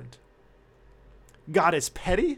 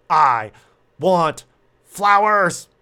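louder, each word hammered out; yell; 8 dB louder, much slower than usual. Retold or imitated. Wife threatening her husband over flowers, yelled word by word. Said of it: "she yells".

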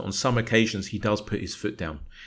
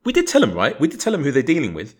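high, louder mock exasperation; imitated face-palm exasperation; 6 semitones higher, 4 dB louder, faster than usual. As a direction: act out the family's face-palm disbelief. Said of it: "they've done a face palm and gone".

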